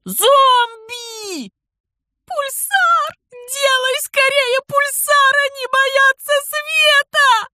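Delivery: high terrified shrieks, very loud; panicked screaming. Acted. (визг в панике)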